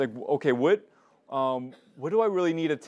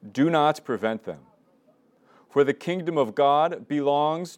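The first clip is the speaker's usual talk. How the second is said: louder, slow scripture reading with long pauses; slow Gospel reading; 4 dB louder than usual. Shown as a demonstration. Leader reading the Gospel aloud for lectio divina, slow and solemn. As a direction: read each phrase deliberately, letting it sink in. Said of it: "I'm just gonna read it slowly".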